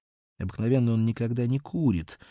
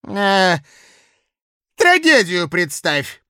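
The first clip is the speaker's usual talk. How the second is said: put-on loud, high drunken bluster; drunken blustering official; 9 semitones higher, 13 dB louder, slower than usual. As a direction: boast it loud like a tipsy clerk. Drunk Kozulin orders.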